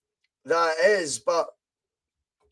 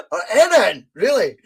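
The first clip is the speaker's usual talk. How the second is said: laughing; 3 semitones higher, 6 dB louder than usual. Real, not acted. Laughing at his own joke.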